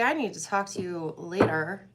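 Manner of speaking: normal talking voice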